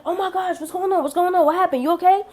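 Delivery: imitated worried grandma, high-pitched questions; alarmed grandma. Grandma on the phone after the fall, alarmed.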